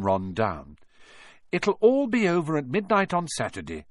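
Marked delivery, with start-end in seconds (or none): 1.5-3.9 put-on lightly raised girl voice, reassuring; calm her friend down gently; Hermione voice.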